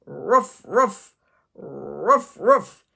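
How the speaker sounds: loud playful woof woof; barking puppy calls; dog barking. Acted. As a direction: bark like a little dog. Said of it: "They heard barking coming from the hole".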